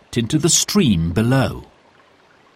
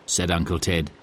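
ordinary delivery, nothing marked

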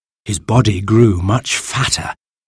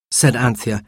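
ordinary delivery

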